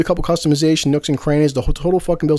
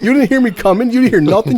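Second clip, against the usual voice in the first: gloating tease; 7 semitones higher, 5 dB louder than usual. Retold or imitated. Gloating at girlfriend after prank.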